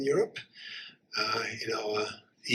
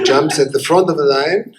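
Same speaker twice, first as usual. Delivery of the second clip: ordinary talk, nothing marked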